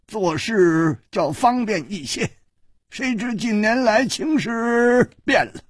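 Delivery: put-on high-pitched old elder, opening on a sigh; high, emphatic old man's voice; explaining old man. A narrator's reading.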